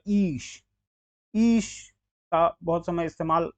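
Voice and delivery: ईश with a drawn-out "ee"; long ee. Shown as a demonstration. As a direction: stretch the vowel of ईश. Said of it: "बड़ी की मात्रा".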